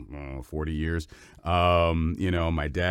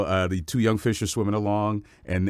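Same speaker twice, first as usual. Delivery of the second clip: ordinary talk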